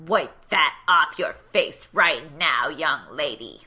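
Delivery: slow, loud little dictator voice; petty tyrant. Retold or imitated. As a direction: bark it like a tiny dictator. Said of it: "in his weird little dictator voice".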